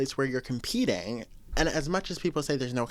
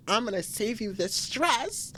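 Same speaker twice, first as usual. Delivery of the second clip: voicing her hand; 6 semitones higher than usual. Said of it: "my hand took a life of its own and said".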